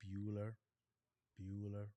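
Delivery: low, droning monotone; Ferris Bueller roll call. Acted. Teacher calling an absent student's name, bored monotone. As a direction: drone the name out, pausing between calls. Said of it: "Anyone who's seen Ferris Bueller".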